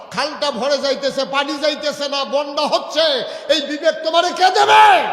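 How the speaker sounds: loud, high-pitched preacher's rebuke; fiery scolding sermon. Real, not acted. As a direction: hammer each question, building to a shout. Preacher's full-force rebuke of listeners.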